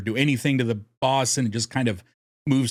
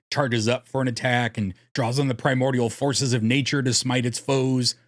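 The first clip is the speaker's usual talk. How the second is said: normal talking voice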